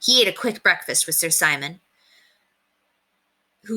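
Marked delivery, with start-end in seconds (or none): none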